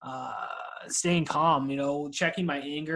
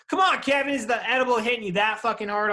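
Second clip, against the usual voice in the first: loud, high ribbing of a friend; teasing shout at Kevin; teasing loud call-out; 6 semitones higher, 6 dB louder than usual. Real, not acted. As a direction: call him out, playfully loud.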